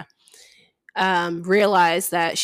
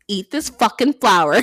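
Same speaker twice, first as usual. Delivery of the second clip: loud, mock-angry command; pissed off; 5 dB louder than usual. Heroine forcing her mate to take the cure, mock angry. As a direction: order it like an irritated heroine. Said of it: "She's pissed".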